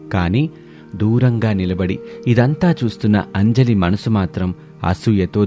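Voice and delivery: usual speaking voice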